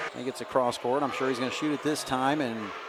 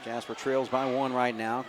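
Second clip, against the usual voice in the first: usual speaking voice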